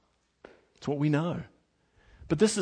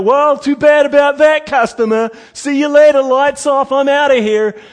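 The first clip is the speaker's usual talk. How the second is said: loud, higher, flippant brush-off; hammed-up couldn't-care-less worker; mock careless quitter; 11 semitones higher, 11 dB louder than usual. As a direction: toss off the customer like you couldn't care less. Mock careless clock-out quote.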